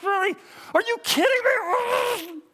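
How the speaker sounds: very high, exasperated mock yell; re-enacted outraged squawk; annoyed outburst. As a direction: shout it in hammed-up disbelief, stretching the end. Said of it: "I was so annoyed".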